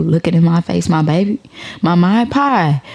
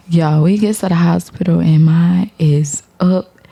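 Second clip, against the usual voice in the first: usual speaking voice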